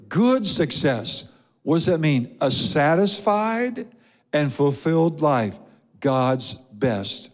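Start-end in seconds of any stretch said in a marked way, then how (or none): 0.1-1.3 "Good" stretched slow and raised; slow, weighty stress on "Good"; emphasis on good. Shown as a demonstration. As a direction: hold "Good" long, then drop onto "success". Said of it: "notice I emphasize the word good".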